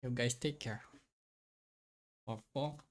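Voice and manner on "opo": ordinary talk, nothing marked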